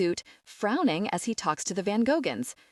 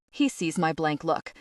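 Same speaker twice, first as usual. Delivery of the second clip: ordinary delivery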